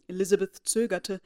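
ordinary delivery

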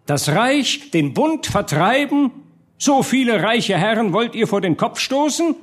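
high, indignant public speech; heated harangue. A narrator's reading. Doctor warns burghers against the spendthrift duke, heated harangue.

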